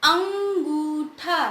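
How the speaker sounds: अंगूठा stretched very slowly, each sound drawn out; slow teacher sounding out of अंगूठा. Shown as a demonstration. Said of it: "जैसे".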